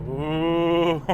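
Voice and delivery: ordinary talk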